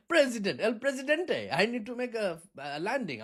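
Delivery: high-pitched put-on Indian accent; accidental Indian accent. Pilot making excuse to Maduro, put-on Indian accent. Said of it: "I'm not sure why we're Indian all of a sudden, accent-wise".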